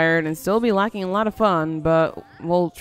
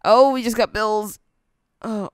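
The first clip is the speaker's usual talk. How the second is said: ordinary talk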